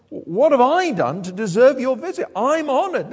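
high, loud, amazed question; astonished humility. Acted. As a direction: marvel at an undeserved honour. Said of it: "she says something even more surprising".

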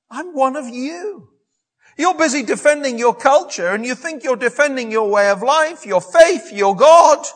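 impassioned high-pitched plea, building to a loud challenge; Paul. Acted. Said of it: "he wants them to know he's one of them".

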